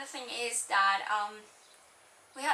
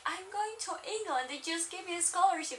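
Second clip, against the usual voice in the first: excited; 6 semitones higher, faster than usual. Retold or imitated. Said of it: "shouting in the streets".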